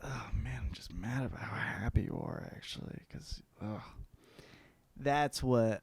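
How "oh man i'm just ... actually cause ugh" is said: resentful hushed whisper; bitter whisper. Retold or imitated. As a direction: whisper it, jealous and sour. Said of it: "you're like".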